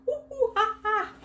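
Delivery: very high monkey hoots; monkey call. Shown as a demonstration. Teacher showing children the sound after each line, monkey call. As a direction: hoot and screech like a monkey. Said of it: "I wanna do a monkey voice".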